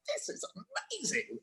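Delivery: high, very soft squeal of delight; tiny excited high voice; hushed glee. Retold or imitated. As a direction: squeal it under your breath. Acting private glee after filming.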